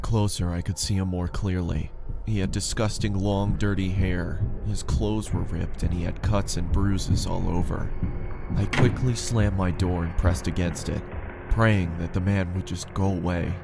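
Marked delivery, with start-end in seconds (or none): none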